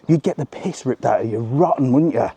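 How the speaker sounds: ordinary talk, nothing marked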